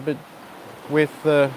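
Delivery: calm yoga teacher guiding a breath sequence; guided breathing cues. Acted. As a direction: talk them through each breath gently. Leads breathing practice.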